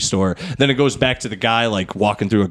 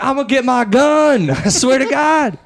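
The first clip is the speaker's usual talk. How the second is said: Medea voice; 3 dB louder than usual. Pretend. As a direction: threaten like a sassy grandma. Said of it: "Medea voice riffing".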